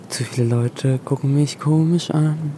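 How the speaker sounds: normal talking voice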